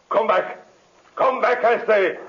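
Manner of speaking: high shouted command; desperate shout. Acted. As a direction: yell after him, frantic. Villain shouting again.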